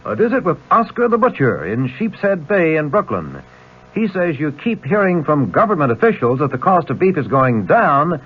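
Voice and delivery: ordinary delivery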